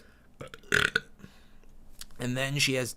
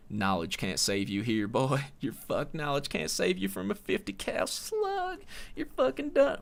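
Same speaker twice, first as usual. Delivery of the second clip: hammed-up Southern accent, climbing much higher and louder to the end; mock southern accent; 7 semitones higher, 3 dB louder than usual. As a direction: play a Southern tough guy with a Southern accent, half joking, over the top. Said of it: "if I'm gonna use a southern accent".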